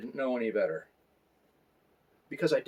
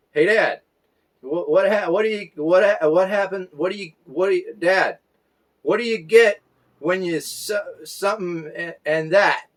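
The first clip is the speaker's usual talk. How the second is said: put-on higher, louder stumbling little boy; little son joking; 5 semitones higher, 5 dB louder than usual. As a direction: stammer out a toddler's joke eagerly. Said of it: "his jokes would go something like this".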